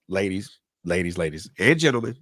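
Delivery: ordinary talk, nothing marked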